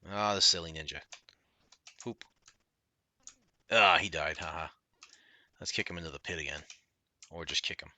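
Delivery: ordinary talk, nothing marked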